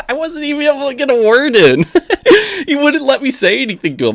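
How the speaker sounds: amused protest broken by laughs; real giggling complaint; laughing in disbelief. Real, not acted. Laughing about the caller's rant.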